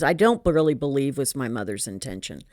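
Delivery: ordinary talk, nothing marked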